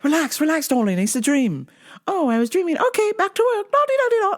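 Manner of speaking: high lilting mock calm; mock dreamy sing-song. Comic acting out the dream scene, mock dreamy sing-song. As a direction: coo like the soothing husband. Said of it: "acting out dream scene".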